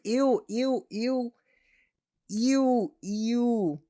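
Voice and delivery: high, loud repeated ews; disgusted. Real, not acted. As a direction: squeal out your disgust. Disgusted reaction to quote.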